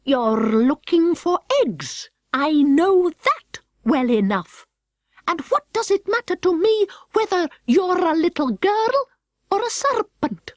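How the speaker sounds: a pigeon who thinks it knows better; high pigeon voice, slowing. Acted.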